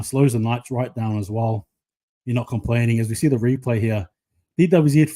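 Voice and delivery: usual speaking voice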